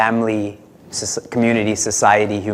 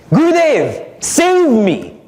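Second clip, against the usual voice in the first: high, loud desperate plea; pleading disciple; 10 semitones higher, 4 dB louder, slower than usual. Acted. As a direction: beg the master for rescue. Disciple begging the guru.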